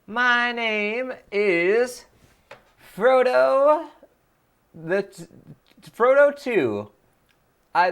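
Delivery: squeaky cartoon character, hammed up; Voice of Nick. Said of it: "one more Voice of Nick voice".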